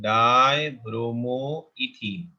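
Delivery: slow dictation. Shown as a demonstration. Teacher dictating name to students.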